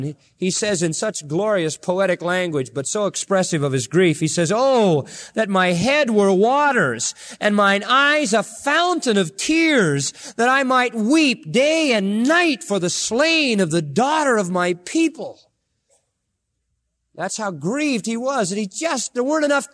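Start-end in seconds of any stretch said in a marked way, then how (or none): 4.5-15.4 higher, slow grieving preacher's cry; preaching lament. Acted. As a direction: mourn it from the pulpit, voice lifted high.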